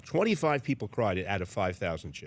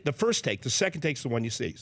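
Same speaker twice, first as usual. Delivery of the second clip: normal talking voice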